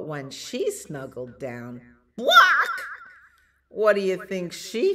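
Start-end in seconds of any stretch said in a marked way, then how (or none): 2.1-2.9 sudden high, loud cluck; hen squawk. Pretend. Storyteller springing the hen on children, shrieked squawk. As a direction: startle them with a chicken squawk. Hen noise in picture book.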